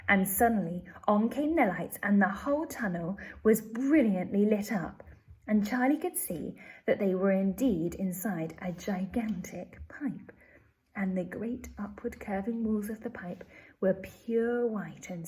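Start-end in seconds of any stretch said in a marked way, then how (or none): none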